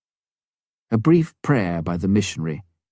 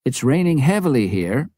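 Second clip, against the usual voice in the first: higher radio reply from base; lightly voiced woman answering over the radio; radio room reporting to the pilot, lightly voiced woman; Norma on the radio; 6 semitones higher, 3 dB louder than usual. A narrator's reading.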